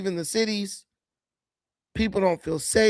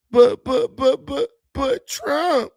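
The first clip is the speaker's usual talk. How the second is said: slow, mocking drawn-out "but Trump", pitched higher; mocking drawl; 5 semitones higher, 5 dB louder, slower than usual. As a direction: parrot their lame excuse, dragging it out. Mocking voters' one-word excuse.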